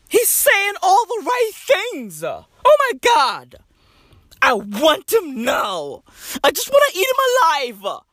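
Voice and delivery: hammed-up lovestruck girl; swooning girls. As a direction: drag out the swoon in a high voice. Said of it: "all the girls be like".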